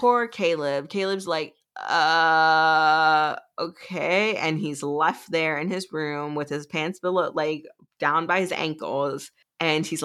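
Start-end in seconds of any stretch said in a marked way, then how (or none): 1.8-4.4 long, loud stalling "uh"; drawn-out mock bafflement, then a hesitant okay; dumbfounded. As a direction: imitate someone totally lost for words. Acting Caleb left dumbfounded.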